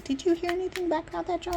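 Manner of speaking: high, put-on mom voice; imitating mom. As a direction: ask it like a prying mother. Voicing her mom.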